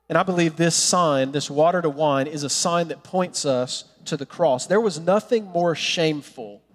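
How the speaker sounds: normal talking voice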